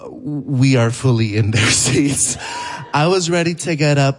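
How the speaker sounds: normal talking voice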